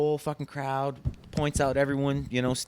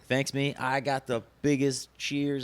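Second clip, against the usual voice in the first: ordinary talk, nothing marked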